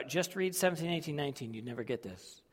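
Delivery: ordinary delivery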